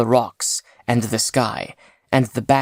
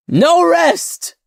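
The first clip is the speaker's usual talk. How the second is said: very high, loud desperate scream; 7 dB louder than usual. A narrator's reading. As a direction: scream it in panic. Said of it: "screamed Sail".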